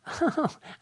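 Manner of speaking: high, light machine-like chuckle; a short programmed giggle before the line; canned robot laugh. Retold or imitated. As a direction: fake cheerful robot laugh. Robot caller laughs first.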